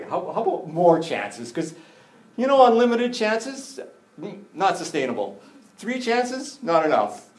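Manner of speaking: high, loud bargaining voice; haggling disciples. Acted. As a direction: haggle up high like at a market stall. Disciples haggling over forgiveness.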